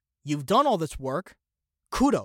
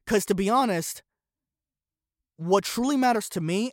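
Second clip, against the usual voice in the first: ordinary delivery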